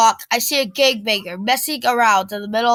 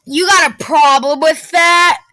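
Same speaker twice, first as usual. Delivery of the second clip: louder, higher threat; bully challenge; 4 semitones higher, 6 dB louder than usual. A narrator's reading. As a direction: square up to him. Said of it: "the gangbanger says".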